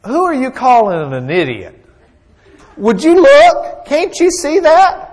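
a squawking donkey demanding he look; indignant donkey. Acted. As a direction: shout at him, outraged and pointing. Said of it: "The donkey says".

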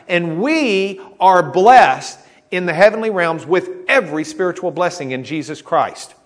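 ordinary delivery

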